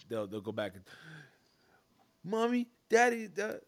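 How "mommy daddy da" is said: put-on high loud kid calling parents; whiny children calling for mom.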